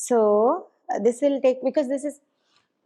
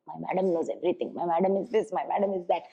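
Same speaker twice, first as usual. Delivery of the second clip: low, soft hint of a pupil; admiring pupil; 7 semitones lower, 7 dB quieter, faster than usual. Pupils name their teacher at home, quiet admiration. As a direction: lightly imitate a child praising teacher, voice dropped. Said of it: "they take your name".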